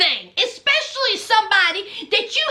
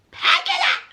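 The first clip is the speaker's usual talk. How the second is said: bossy command; 12 semitones higher than usual. Retold or imitated. Ordering friends to pack.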